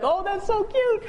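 high, slow, laughing coo; cooing delight. Retold or imitated. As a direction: melt at something adorable. Imagined Kobe melting at kids.

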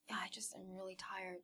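very soft, whispery imitation of weariness; weak, tired reply. Sick daughter answers mom from bed, faint and tired. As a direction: barely voice it, as if worn out.

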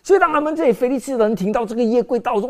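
ordinary delivery

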